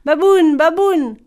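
loud, high call to wake someone. A narrator's reading. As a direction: holler the name up into a tree. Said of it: "Boon Boy l'appela". (appel fort et aigu)

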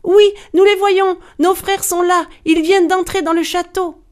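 high, loud, jubilant shout; excited cries. A narrator's reading. Sisters shouting that the brothers are here, excited cries. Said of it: "Ses sœurs s'écrièrent".

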